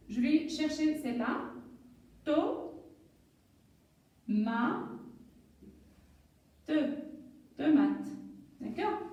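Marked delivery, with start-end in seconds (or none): 2.2-6.7 "To, ma, te" with long pauses between; syllable by syllable. Shown as a demonstration. Teacher building a word, "To, ma, te" widely separated. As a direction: hold each syllable apart before blending. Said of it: "un petit jeu de lecture".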